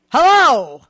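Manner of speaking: loud, drawn-out mocking "hello"; sarcastic hello. Real, not acted. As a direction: ring it out in disbelief. Host mocks a tweet's claim.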